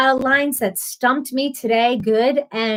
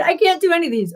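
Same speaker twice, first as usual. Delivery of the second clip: usual speaking voice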